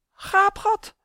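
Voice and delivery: high, loud, startled blurt; frightened stammer. A narrator's reading. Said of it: "stottert Chris". (geschrokken, hoog uitgeroepen)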